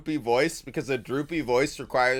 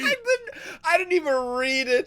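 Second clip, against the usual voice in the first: high, laughing disbelief. Real, not acted. Streamer amazed his guess matched, laughing high. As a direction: squeak it out mid-laugh.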